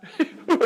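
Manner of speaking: high giggling laugh. Real, not acted. Laughing after mimicking his wife, high giggle.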